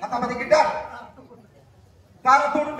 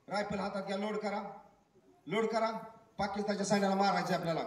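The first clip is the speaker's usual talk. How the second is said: hushed order; 3 semitones lower, 9 dB quieter than usual. Acted. Quiet battlefield instructions.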